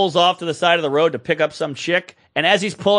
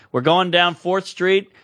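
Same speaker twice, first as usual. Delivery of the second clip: normal talking voice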